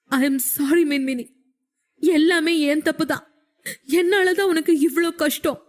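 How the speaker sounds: a man weeping as he begs forgiveness; tearful apology. A narrator's reading. Husband apologises to his wife for the loss, weeping. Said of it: "கதறிக் கொண்டிருந்தான்".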